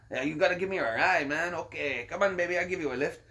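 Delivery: fast stoner patter; a hint of a laid-back stoner; Cheech impression. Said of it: "Cheech was".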